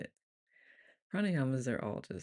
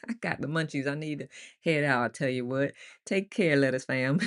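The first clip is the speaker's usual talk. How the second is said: louder, playful country twang; big country send-off; 5 dB louder than usual. Pretend. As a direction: keep the hammed-up country accent going. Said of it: "read in the country voice".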